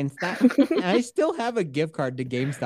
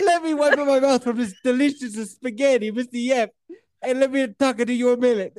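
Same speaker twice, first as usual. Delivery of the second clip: high cartoon Italian through giggles; mock Italian accent; 7 semitones higher than usual. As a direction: spoof an Italian kid, barely holding back laughter. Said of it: "Sounds Italian".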